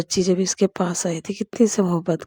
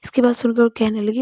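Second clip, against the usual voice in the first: plain reading voice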